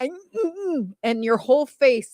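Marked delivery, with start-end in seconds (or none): 0.0-0.9 high strained held-in sneeze; imitated stifled sneeze. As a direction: squeeze back a sneeze. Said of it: "you literally go".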